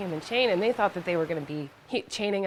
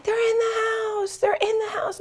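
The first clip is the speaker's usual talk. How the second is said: high, strained screaming cry, breaking toward a whisper; retold frantic scream; 4 dB louder than usual. Neighbor screaming to the rescuers that they're still inside, frantic high cry. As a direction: shriek it like you're begging for help. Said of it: "she's screaming and crying".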